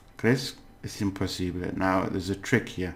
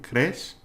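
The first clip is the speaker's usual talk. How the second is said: "crees" with a high rising end; question intonation; 5 semitones higher than usual. Shown as a demonstration. Teacher showing how to ask in Spanish, questioning rise. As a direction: lift "crees" sharply into a question. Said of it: "it like a question".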